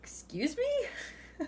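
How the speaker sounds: drawn-out, high mock-offended question; disappointed disbelief. Reader acts out someone who's been let down, mock offended. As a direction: play it affronted, slow and high, near laughter. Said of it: "when someone disappoints you like that".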